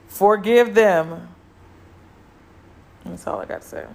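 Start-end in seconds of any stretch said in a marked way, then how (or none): 0.1-1.3 slow, loud and drawn out; a final loud, slow plea to forgive; emphatic plea. Real, not acted. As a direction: lean hard into each word of Forgive them.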